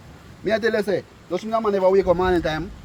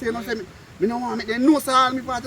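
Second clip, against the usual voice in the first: ordinary talk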